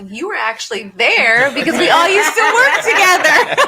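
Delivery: high, loud laughing accusation; high laughing call-out; laughing tease. Real, not acted. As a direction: laugh while calling him out. Laughing, teasing coworker.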